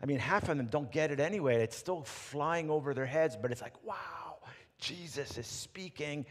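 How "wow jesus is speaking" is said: soft, slow, awed hush; hushed awe. Retold or imitated. Disciples hushed as Jesus speaks, softly awed. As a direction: breathe it like you're in awe.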